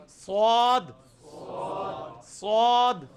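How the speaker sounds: emphatic, heavy ص in سواد; thickened S. Shown as a demonstration. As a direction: thicken the S of سواد, whistle included. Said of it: "صرف اس کو موٹا کر کے پڑھنا ہے".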